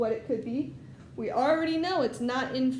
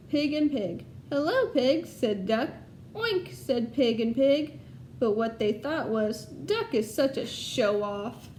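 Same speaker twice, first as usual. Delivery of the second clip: lively storybook read-aloud to a class; reading to children. Acted. Teacher reading Duck on a Bike to her class, storytelling voice. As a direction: tell it brightly so the kids follow along.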